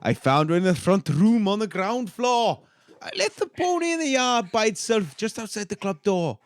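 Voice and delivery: put-on Welsh accent reading a statement. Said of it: "how good his Welsh accent is".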